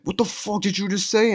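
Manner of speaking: high-pitched imitation of an affronted guy; mock angry challenge. Acts out stranger's angry challenge.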